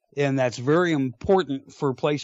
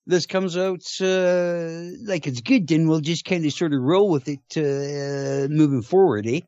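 hammed-up Canadian accent, higher, ending on eh; fake Canadian accent; 4 semitones higher than usual. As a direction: lay the Canadian accent on thick. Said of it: "A Canadian doing a fake Canadian accent".

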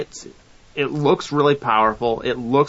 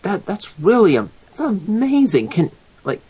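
amazed mom; 6 semitones higher than usual. Retold or imitated. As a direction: imitate mom's high-pitched amazement. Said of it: "and she goes".

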